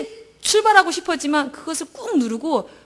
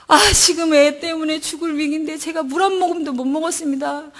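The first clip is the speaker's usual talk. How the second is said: high, louder wailing plea; sobbing desperate prayer; frantic crying out; 4 semitones higher, 3 dB louder than usual. Retold or imitated. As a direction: cry out like you've lost your mind. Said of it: "정신 나간 처럼 제가 부르짖었어요".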